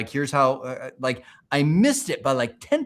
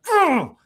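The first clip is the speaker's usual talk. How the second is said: sharp high "ugh" of annoyance at missing; frustrated groan; 14 semitones higher, 6 dB louder than usual. Retold or imitated. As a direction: groan it out like you just failed by a hair. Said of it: "it's more of, like, anger".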